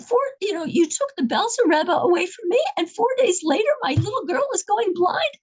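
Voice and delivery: high, anguished pleading to heaven; desperate plea to God. Retold or imitated. Father crying out to God at night.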